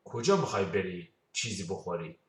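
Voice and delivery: ordinary talk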